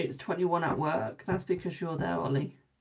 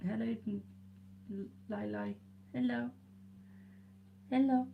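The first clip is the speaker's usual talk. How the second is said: pet talk; 3 semitones higher, 8 dB quieter than usual. Real, not acted. Cooing at the cat on her lap.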